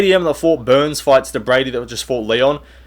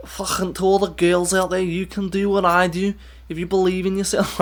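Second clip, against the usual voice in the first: higher, softer attempt at a female Scouse accent; half-hearted Scouse accent, higher and softer; Molly McCann Scouse accent; 6 semitones higher, 5 dB quieter than usual. Mocking a fighter's speech in a rough female Scouse accent. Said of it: "I can't really do a female Scouse accent".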